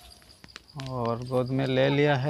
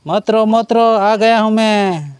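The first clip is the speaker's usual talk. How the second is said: high, raised soothing call, drawn out at the end; lifted, louder hush-now voice; 6 semitones higher, 5 dB louder than usual. Real, not acted. Calling out to his crying baby.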